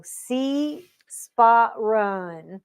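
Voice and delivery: very slow, drawn-out reading, word by word; stretched early-reader recitation; child learning to speak. Retold or imitated. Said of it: "tape of me learning how to speak".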